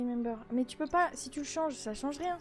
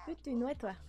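ordinary delivery

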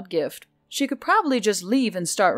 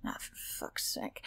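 slow unvoiced whisper under the breath; muttered curse; 18 dB quieter, slower than usual. A narrator's reading. Barista cursing at a sweaty tip, whispered mutter. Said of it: "Emily muttered".

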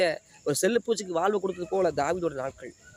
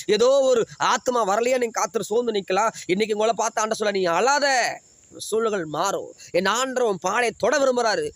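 higher, louder climactic pulpit preaching; fervent preaching; 4 semitones higher, 3 dB louder than usual. Acted. Pastor assuring sufferers God will lift them, fervent. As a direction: lift the promise high like a rallying cry.

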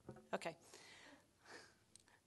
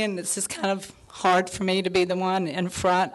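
ordinary delivery

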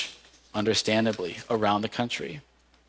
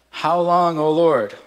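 raised, higher lament cry; 5 semitones higher, 5 dB louder, slower than usual. Retold or imitated. Preacher crying out the lament for the church, louder and higher. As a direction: cry it out to the Lord, pleading. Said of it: "we cry out".

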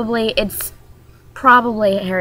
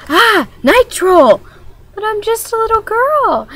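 much higher, loud mock panic; panicked girl; 14 semitones higher, 5 dB louder than usual. Vlogger acting a girl meeting the troll, squealing. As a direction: squeal it like a terrified little girl.

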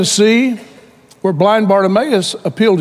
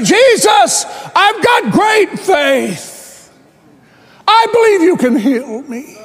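boastful cry; 10 semitones higher, slower than usual. Retold or imitated. Blind man boasting of his faith to Jesus, mock shout. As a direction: show off your faith to Jesus at full volume.

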